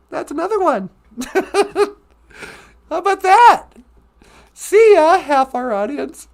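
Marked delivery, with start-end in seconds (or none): 1.3-6.3 gleeful laughing jab; laughing, louder. Real, not acted. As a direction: laugh it out loud and cheeky. Laughing taunt at the audience.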